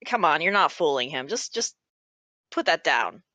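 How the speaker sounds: tired, mildly exasperated telling-off; weary exasperation. Acted. Sarah tells Greg to put the gun down, wearily exasperated. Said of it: "sighs, and she is like".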